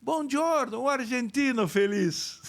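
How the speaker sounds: high, mock-Italian bank clerk greeting; mock Italian bank manager. Bank manager mistakes him for Argentine, high mock-Italian greeting. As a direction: play the manager, amused, greeting a foreigner.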